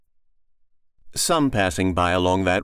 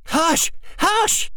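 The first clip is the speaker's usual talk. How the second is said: frantic hush. Acted. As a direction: shut her up fast. Mother silences child.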